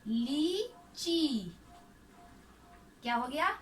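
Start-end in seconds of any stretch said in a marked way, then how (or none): 0.1-1.5 "ली ची" very slow, both ee vowels held; stretched syllables. Shown as a demonstration. Teacher joining two syllables into a word, slowly drawn out. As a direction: split "ली ची" into two long, separate syllables. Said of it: "जोर लगाकर खींचकर".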